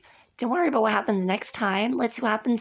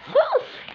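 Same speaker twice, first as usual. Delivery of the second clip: sharp high gasp. Acted. Shocked at the insult.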